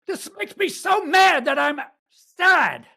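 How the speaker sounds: high, loud mock-furious yell; mock angry shout. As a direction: bellow it like a man hiding sadness in rage. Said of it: "What he's gotta do is say".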